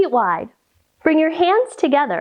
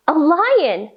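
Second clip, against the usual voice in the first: ordinary delivery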